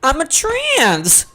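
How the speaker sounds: high, slow, rising shriek; mock panicked realization. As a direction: play the horrified future self, voice cracking upward. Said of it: "and I'm like".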